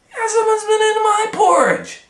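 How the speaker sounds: squeaky high bear voice, loud; Mama Bear. Acted. Mama Bear finding her porridge touched, squeaky and loud. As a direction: squeal it like an upset mother bear. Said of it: "And Mama Bear said".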